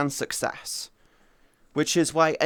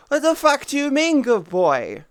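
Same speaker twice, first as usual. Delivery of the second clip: indignant outburst; 9 semitones higher, 6 dB louder than usual. Real, not acted. Reacting to viewer's comment.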